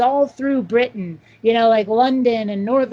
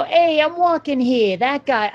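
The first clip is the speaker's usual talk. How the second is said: mock Brooklyn Italian street accent; 3 semitones higher than usual. Guest shows the voice he wants for text-to-speech, Brooklyn Italian. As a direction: call it out like a Brooklyn Italian guy. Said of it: "sort of Italian from Brooklyn guy".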